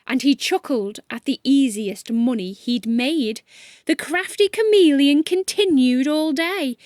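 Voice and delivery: ordinary delivery, nothing marked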